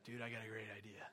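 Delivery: low, hushed dude voice; mock-conspiratorial, soft and low; acted dude aside. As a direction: play an idol builder quietly pitching an idea. Acted reply of idol builders.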